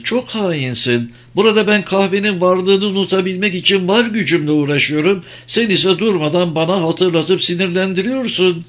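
woman's mildly indignant grumble; scolding companion. Acted. Woman scolds him about coffee.

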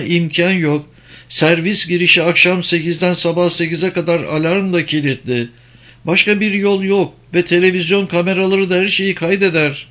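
high-pitched, insistent woman; high female character voice; agitated German woman. Acted. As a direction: warn them as the woman, pitched well up. German woman explains the obstacles.

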